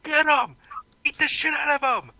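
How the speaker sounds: high-pitched rallying yell; yelling incitement. Acted. Leader yelling at protesters to start kicking asses, high rallying cry. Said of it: "he's just yelling at the protesters".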